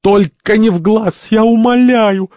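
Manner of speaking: very high, loud pleading shriek; squealing. Acted. Santori begs to spare his eye, squealing. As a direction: squeal for mercy, drawn out. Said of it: "Сантори визжит".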